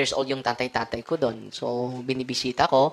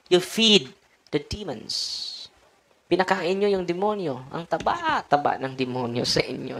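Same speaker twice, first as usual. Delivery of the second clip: raised, slow and emphatic sermon lines; impassioned preaching; 5 semitones higher than usual. Acted. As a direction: preach it out, drawing out the key words. Preaching against fear of demons.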